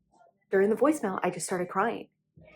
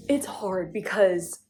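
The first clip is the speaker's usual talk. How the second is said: near tears; much slower than usual. Real, not acted. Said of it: "And I might start crying".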